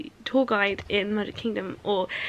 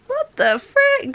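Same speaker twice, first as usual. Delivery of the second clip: very high, loud, slow what the frick; stunned by the twist; shocked; 7 dB louder, much slower than usual. Real, not acted. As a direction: gasp it out in disbelief. Shocked by a reveal.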